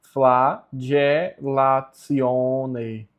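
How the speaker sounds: "flagellatione" drawn out, fla-dje-la-tsi-o-ne; syllable-by-syllable demo. Shown as a demonstration. Teacher giving the correct pronunciation, broken into syllables. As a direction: loudly sound out each syllable of "flagellatione". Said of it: "a pronúncia correta é".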